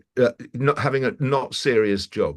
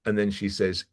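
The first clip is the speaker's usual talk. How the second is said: normal talking voice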